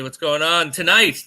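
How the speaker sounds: ordinary talk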